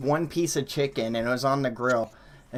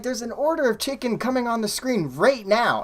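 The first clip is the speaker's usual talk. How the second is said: high-pitched, insistent pleading of a case; retold heated protest; 9 semitones higher, 3 dB louder than usual. Employee arguing with manager over chicken order, high retold protest. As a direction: argue it urgently, voice pitched up.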